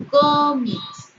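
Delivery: "cómics" with a loud, high, drawn-out CÓ; stress on first syllable. Shown as a demonstration. Teacher giving a llana exception, stressed CÓ in "cómics". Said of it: "Sería una palabra que es llana o grave".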